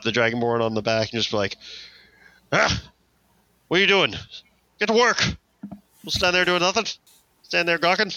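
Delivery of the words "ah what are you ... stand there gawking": high, pushy deckhand barking orders; bossy sailor voice. Acted. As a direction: scold the idle crew from up high. Player voices Fritz scolding crew.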